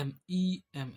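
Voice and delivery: teacher spelling एम ई एम letter by letter. Shown as a demonstration. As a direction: say each letter एम ई एम apart and clearly. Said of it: "ऐसे एम का sound आएगा".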